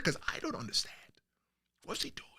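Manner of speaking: soft unvoiced whisper; puzzled aside whispered to viewers. Real, not acted. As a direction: confide your confusion under your breath. Confused aside about [name].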